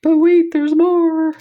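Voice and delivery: over-the-top infomercial shout; infomercial announcer. Pretend. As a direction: sell it like a late-night infomercial host. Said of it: "I feel like an infomercial".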